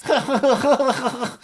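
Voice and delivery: high hammed-up villain cackle; villainous laugh. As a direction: cackle like a Russian supervillain. Putin villain impression ends in laughter.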